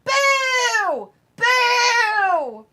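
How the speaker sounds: shrill booing heckler yelling insults; swearing tirade. Pretend. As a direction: scream abuse from the crowd. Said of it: "I'd be like".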